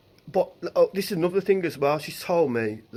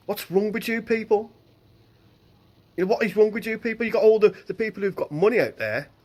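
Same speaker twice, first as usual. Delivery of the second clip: raised, exasperated demand; angry outburst; 6 semitones higher, 3 dB louder than usual. Real, not acted. Ranting at greedy panic buyers, angry and raised.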